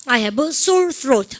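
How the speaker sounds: slow, loud model sentence; teacher drilling slowly; slow model phrase. Shown as a demonstration. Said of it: "시작".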